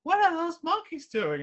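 put-on high, squeaky child asking; little kid voice. Cartoon kid asks dad at the zoo, high and squeaky. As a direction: pipe up like a curious little kid. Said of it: "the kids are at the monkeys and they're like".

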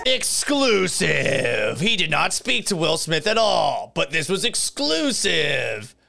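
drawn-out, hyped "exclusive"; sarcastic tabloid announcer, stretching out the big word; mock breaking news. Host mocking a news clip's so-called scoop, mock-hyped announcer.

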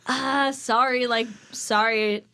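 very slow, drawn-out sorry; hammed-up sheepish apology, dragged out; awkward apology. Acted awkward repeated apology.

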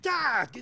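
high, loud cry of fright; startled yelp. Acted. Inspector caught gossiping by his housekeeper, startled yelp.

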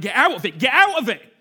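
loud, urgent shout; shouting command. Acted. Preacher as Jesus shouting to the listener to leave judgment. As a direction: shout it out twice, pleading hard.

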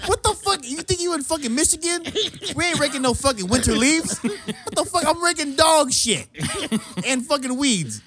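very high, squealing indignation; worked-up comic rant. Real, not acted. Host ranting to friends about dog shit, comic indignation.

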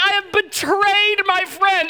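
high, slow, hammed-up anguish; mock devastation. Pastor caught with a Coke, hammed-up high anguished cry. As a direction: wail it like a mock tragedy.

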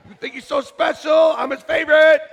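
high laughing mock bragging; mock jealous neighbour. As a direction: tease the neighbour playfully. Mock sibling rivalry banter.

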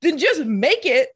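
high exasperated complaint; incredulous, fed-up rant. Retold or imitated. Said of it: "I'm like".

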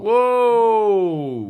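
long, high, loud whoop; cheering. Real, not acted. Co-host greeting a new patron, loud drawn-out cheer. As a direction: draw out a loud rising cheer.

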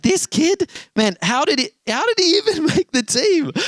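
high-pitched disbelief; hammed-up incredulity; incredulous. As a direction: exclaim it like a baffled parent in the stands. Watching dad reacts in disbelief.

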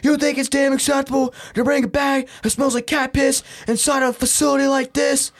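high, indignant guard's rant; mock yelling. Security guard lecturing a traveler about his bag, mock outraged yelling. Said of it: "And I hear yelling".

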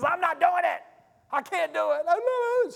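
high-pitched panicked woman's protest, dragged out at the end; hysterical refusal. Acted. Panicking woman refuses the hero's order to jump, hysterical. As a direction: act out someone losing it and refusing. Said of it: "she's lost it".

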